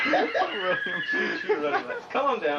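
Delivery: high, loud words through real laughter; laughing. Real, not acted. Crew member teases presenter over the Choo Choo slip, laughing. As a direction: crack up while talking.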